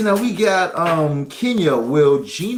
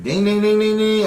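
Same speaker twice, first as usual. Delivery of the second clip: mock bell; 9 semitones higher, 5 dB louder than usual. Bell sound for a correct answer.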